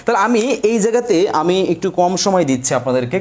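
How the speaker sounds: ordinary talk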